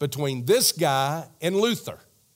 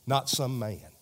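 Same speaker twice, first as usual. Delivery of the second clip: ordinary delivery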